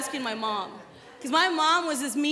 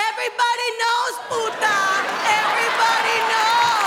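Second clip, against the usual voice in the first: gossipy mom; 7 semitones higher, 4 dB louder than usual. Retold or imitated. Mom gossiping about neighbor.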